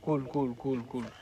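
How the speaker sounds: quiet reassurance to a crying friend; soothing hush. Real, not acted. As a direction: settle her down gently with each word.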